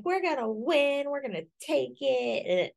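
high, overconfident cheering; cocky cheer. Retold or imitated. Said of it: "You guys were just like".

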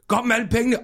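high-pitched stage robber's call; robber's demand. Acted. Comedian playing a robber in his act, high demand. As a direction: call out the hold-up line high. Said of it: "han sagde".

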